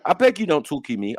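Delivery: usual speaking voice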